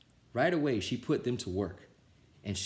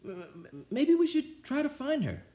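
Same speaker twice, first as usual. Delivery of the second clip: put-on stammering kids. A narrator's reading. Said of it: "they said".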